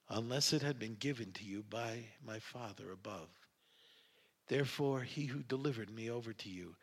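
low, very soft, measured voice; calm answer. A narrator's reading. Jesus answers Pilate about authority, calm and quiet.

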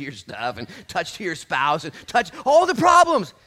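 slow, loud, rising to a yell; building preacher's shout. Real, not acted.

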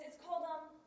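imitating clueless husband. Husband tells wife the title, mock-clueless. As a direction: sound it out as if unfamiliar.

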